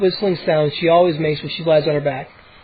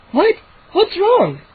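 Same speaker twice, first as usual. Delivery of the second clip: surprised; 13 semitones higher, slower than usual. A narrator's reading. Mom startled awake.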